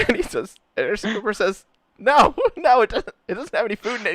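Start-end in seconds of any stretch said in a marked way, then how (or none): 2.0-4.1 much higher, laughing through the no; can barely hold the laugh; giggling denial. Retold or imitated. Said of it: "He starts to laugh".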